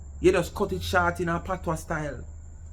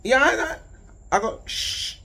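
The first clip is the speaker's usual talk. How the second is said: ordinary talk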